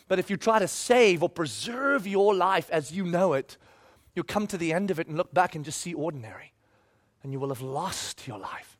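a grave, hushed Jesus warning; solemn warning. Retold or imitated. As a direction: let the warning sink to a hush. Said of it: "Jesus was the one that said".

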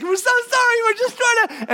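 high, breathless apology; flustered women. Acted. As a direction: blurt it out in a rush. Women bump into Jesus.

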